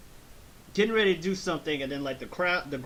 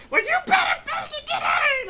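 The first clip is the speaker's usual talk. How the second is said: high, loud mock shriek; furious mother; 8 dB louder, faster than usual. Mother throwing Krillin out, high screeching mock fury. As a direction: scream them out of the house, hammed-up. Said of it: "she's just like".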